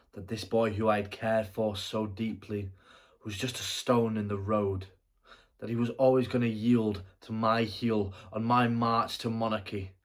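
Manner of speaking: loud, emphatic theatrical declaration; forceful self-justification. Acted. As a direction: drive each phrase home with force. Justifying the boy's death.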